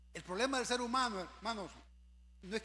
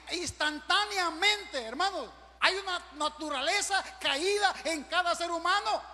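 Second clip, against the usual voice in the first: loud, high pulpit exhortation; raised, forceful preacher delivery; full-force preaching; 7 semitones higher, 7 dB louder than usual. Acted. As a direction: drive it home at full voice.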